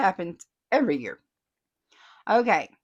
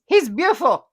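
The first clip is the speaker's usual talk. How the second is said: higher, louder gushing read; 6 semitones higher, 9 dB louder than usual. Retold or imitated. Host reading a fan comment, gushing loudly. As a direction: gush over the bear.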